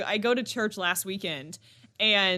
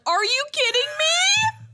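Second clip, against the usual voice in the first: much higher, louder, slow outraged cry; outraged shriek; 7 dB louder, slower than usual. Retold or imitated. Mom to nursery staff, higher, louder and outraged. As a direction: shriek it in disbelief. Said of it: "And I'm like".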